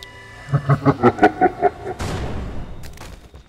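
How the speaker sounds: rolling villain chuckle; hammed-up slasher laugh; evil chuckle. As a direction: chuckle darkly over your catch. Villain laugh after catching someone.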